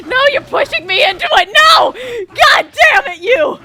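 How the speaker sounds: high, loud, panicked wail; panicked crying shout. Real, not acted. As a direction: cry out in real panic at the game. Panicking at the game.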